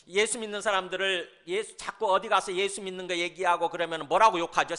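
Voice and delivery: ordinary talk, nothing marked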